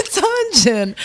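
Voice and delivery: high retold answer after laughing; high playful reveal. Guest repeating the dungeon news to mother, high reveal.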